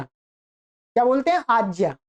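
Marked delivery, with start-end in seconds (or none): none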